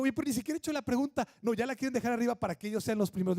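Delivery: put-on a father rushing to stop his children answering; exasperated dad. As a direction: hurry through it, fed up. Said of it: "les digo".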